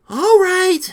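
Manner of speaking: very loud, high outburst; exasperated. A narrator's reading. Rita reluctantly turning back to the lizard, exasperated shout.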